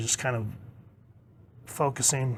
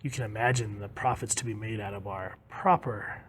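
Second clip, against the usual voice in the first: hushed villain, fading to a near whisper; creepy; 3 semitones lower, 8 dB quieter than usual. Acted. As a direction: sink softly into sinister relish. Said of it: "got a little more creepy".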